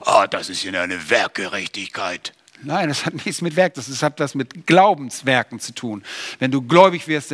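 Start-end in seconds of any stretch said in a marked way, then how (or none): 0.0-2.3 lower, scoffing voice of a doubter; mocking objector. Preacher mimics a worried listener, lower and scoffing. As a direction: sneer it like a sceptical churchgoer. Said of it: "viele haben Angst davor".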